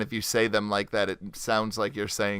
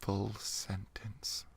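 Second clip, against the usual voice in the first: slow unvoiced whisper; 4 semitones lower, 17 dB quieter, much slower than usual. Echoing the friend's words in a slow whisper. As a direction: breathe the words out slowly. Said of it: "I don't think I could whisper".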